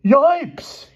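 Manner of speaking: very high, loud yelp; alarmed cry. A narrator's reading. Boy caught with evidence in his pocket, high alarmed cry. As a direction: yelp in sudden panic. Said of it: "he cried".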